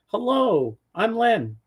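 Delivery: high, drawn-out friendly hello; Len's eager greeting. Retold or imitated. Len greets the newcomer beside him, eager high greeting. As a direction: imitate an eager welcome, pitched well up.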